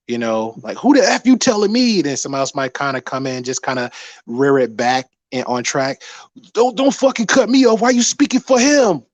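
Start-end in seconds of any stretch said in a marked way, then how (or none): 0.8-2.0 sharp, high, loud retort; angry. Retold or imitated. Acting out heated debater. 6.5-9.0 high, loud furious outburst; angry. Retold or imitated. Acting out heated debater.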